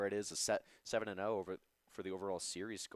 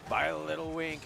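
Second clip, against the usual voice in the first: high, excited esports commentary; live play-by-play; 6 semitones higher than usual. Acted. Co-caster follows saves and shots, excited play-by-play. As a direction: ride each shot up in pitch, then settle.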